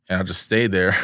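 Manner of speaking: ordinary talk, nothing marked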